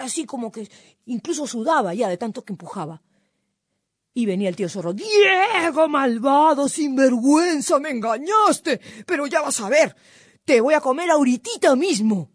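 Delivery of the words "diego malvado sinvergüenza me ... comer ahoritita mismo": loud, high outraged yelling; storybook villain in a rage; furious fox. A narrator's reading. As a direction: roar out the accusations, stretching the insults. Angry fox confronts Diego who tricked him.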